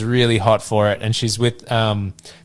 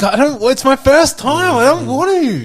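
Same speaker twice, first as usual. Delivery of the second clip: put-on high nervous teen; 13 semitones higher, 3 dB louder than usual. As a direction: whimper it like a pressured kid. Voicing a shy teen.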